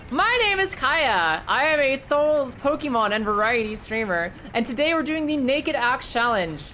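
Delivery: high, loud, slow streamer intro. Acted. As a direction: announce yourself like a host. Intro for raiders.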